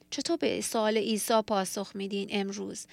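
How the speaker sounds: usual speaking voice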